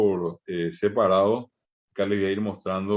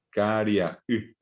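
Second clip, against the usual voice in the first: Caria y slowly, with a break before the y; slow teacher's demo of Caria y; separated syllables; slower than usual. Shown as a demonstration. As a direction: split the voice before the final y. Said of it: "separo la voz allí".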